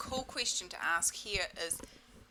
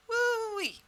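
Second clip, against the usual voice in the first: high woo-wee; 7 dB louder than usual. Real, not acted. Coach whooping after the clearing, high and loud.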